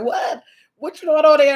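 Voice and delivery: loud, high-pitched retold question; retold surprise. Friend reacting to news of her YouTube channel, surprised. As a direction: act out his surprised reaction.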